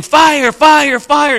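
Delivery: high-pitched excited chanting; hog-wild worshipper. Retold or imitated. Preacher mimics people shaking on the platform, frenzied high cries. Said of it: "And they're saying".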